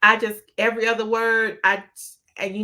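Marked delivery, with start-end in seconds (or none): none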